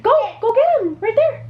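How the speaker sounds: very high, eager urging. Real, not acted. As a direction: cheer the little one on. Urging toddler to go get daddy.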